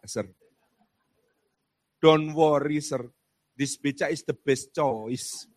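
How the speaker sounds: put-on confident Javanese-accented English of a Yogyakarta becak driver. Becak driver touting a tourist, confident Javanese English. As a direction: sell the ride like a cheerful pedicab driver in a Javanese accent. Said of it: "tukang becak ... sangat percaya diri".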